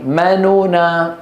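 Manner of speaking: ما نونا drawn out slowly, ghunna held on نونا; slow model recitation. Shown as a demonstration. Teacher slowly models ما نونا for students. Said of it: "طب قولوا ورائي".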